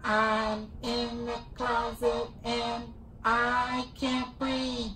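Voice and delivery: alien voice. Acted. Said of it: "I got my alien voice".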